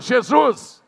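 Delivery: loud high proclamation of Jesus; shouted name. Acted. Preacher proclaims Jesus to the congregation, loud cry.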